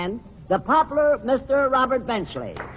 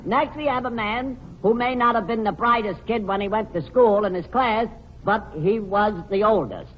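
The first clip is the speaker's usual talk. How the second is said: normal talking voice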